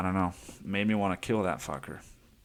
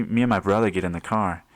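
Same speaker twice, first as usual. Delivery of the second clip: normal talking voice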